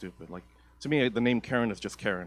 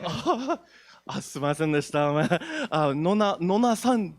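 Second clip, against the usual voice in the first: mock Japanese accent; 7 semitones higher, 4 dB louder than usual. Apologizing in mock Japanese style.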